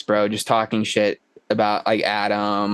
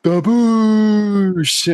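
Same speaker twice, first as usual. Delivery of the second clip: high, loud drawn-out shout; stretched, high-pitched call from the audience; audience heckle; 10 semitones higher, 5 dB louder than usual. Acted. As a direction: holler it long like someone yelling from the crowd. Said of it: "like, the audience going".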